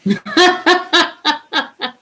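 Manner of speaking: high burst of laughter. Real, not acted. Laughing alone on camera.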